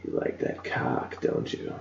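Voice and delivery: creepy seductive whisper; soft, mock loving. Host creeping out co-hosts with a fake come-on, hushed whisper. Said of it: "you gotta say it lovingly".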